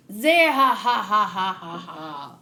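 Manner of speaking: odd-voiced villain cackle; hammed-up Moria laugh. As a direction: cackle like a gloating ghoul lord. Voicing villain's signature laugh.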